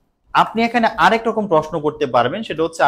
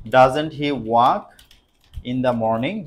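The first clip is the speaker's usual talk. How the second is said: slow, drawn-out dictation; 2 semitones lower, much slower than usual. Shown as a demonstration. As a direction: dictate it again word by word. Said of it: "slow dictation again". (ধীরে ধীরে বলা)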